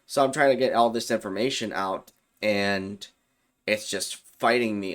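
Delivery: ordinary talk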